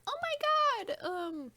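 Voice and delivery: high, squeaky mocking girly voice; TikTok girl imitation. Mocking an elite TikTok girl, high mock-girly voice. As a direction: mimic her in a high-pitched singsong with a tittering giggle. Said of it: "She's that girl who's like".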